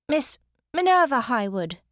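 loud, high, emphatic announcement; called-out clarification. A narrator's reading. Minerva clarifies who is knocking, calling out loudly. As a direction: spell out the full name loudly. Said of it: "she clarified".

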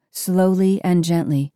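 ordinary delivery

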